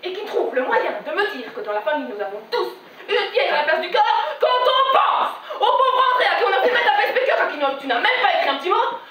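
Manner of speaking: indignant tirade rising high and loud; outraged mother. Acted. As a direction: build to a high, loud accusation. Mother's tirade to daughter on phone. (tirade de mère indignée, qui monte dans l'aigu)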